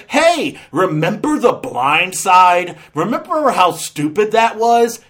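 loud, pushy mock reminder; mock nagging. Reviewer mimicking a one-joke character, mock nagging.

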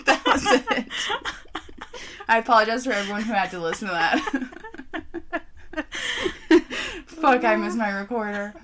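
usual speaking voice

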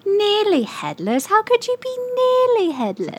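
high-pitched little elf voice; Dobby. Acted. Asked to do a character, in a terrible high Dobby voice. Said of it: "Do, like, Dobby or something".

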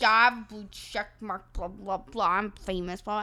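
mock the cocky guy's boast; a lightly voiced, drunk show-off, mocking; mock bragging drunk. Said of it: "he's like".

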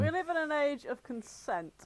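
normal talking voice

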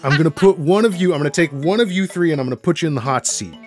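normal talking voice

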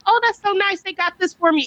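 high, sugary mock gratitude; imitating her gushing over a present. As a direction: gush like she loves a gift. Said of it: "you will be like".

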